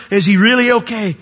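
high, loud, insistent question; urgent demand. Acted. Joseph pressing his brothers about his father, loud and insistent. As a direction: press hard for an honest answer.

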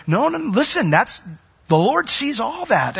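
high, urgent cut-in, slowing on the Lord; emphatic interruption. Real, not acted. Preacher cutting off the excuse with urgent insistence. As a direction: stop them short and set them straight.

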